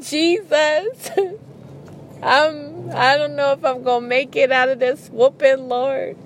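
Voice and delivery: high, pleading kid's prayer, a touch of mock crying. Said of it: "'Cause I'd be like".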